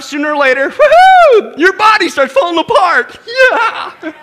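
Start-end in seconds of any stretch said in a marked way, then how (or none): 0.7-1.6 high, loud sarcastic whoop; mock woohoo. Preacher teasing the congregation about aging with a mock woohoo. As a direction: cheer as if falling apart were great news.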